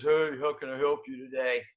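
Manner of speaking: a half-hearted "how can I help you"; insincere clerk greeting. Retold or imitated. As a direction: imitate a bored clerk who doesn't mean it. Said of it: "the intonation was not good".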